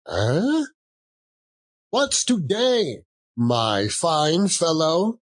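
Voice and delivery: put-on gleeful old man calling down to a boy; excited Scrooge. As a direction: coax the boy from the window.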